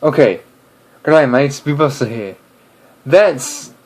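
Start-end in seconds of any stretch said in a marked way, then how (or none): 1.0-2.4 imitated Australian greeting; cheery Aussie-accent video intro; B Buster. Shown as a demonstration. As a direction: copy an Australian YouTuber's hello. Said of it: "I can do Australian pretty good".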